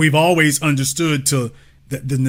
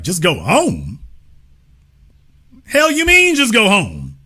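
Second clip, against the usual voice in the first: mock outrage at the idea; incredulous echo; 3 semitones higher than usual. Host retelling his own reaction, incredulous. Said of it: "I'm like".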